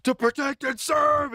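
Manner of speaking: high, slow, hammed-up police shout; mock cop bark. Streamer mocks a cop in the story, high slow mock shout. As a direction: bellow it like a parody cop. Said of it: "is being told".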